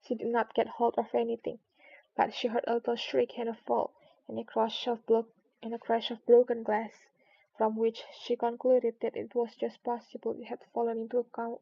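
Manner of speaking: plain reading voice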